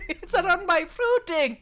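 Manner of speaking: high, loud, hammed-up nanny outcry; Missus Doubtfire. Player quoting movie back to friends, Missus Doubtfire impression. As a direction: cry it out shrilly as the movie nanny. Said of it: "consistently be Missus Doubtfire".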